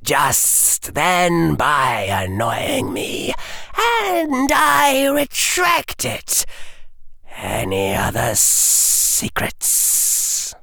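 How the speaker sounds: high, slow menacing villain voice; cold rasping evil emperor. Acted. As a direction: threaten like an ancient tyrant. Dark Lord offers cruel mercy.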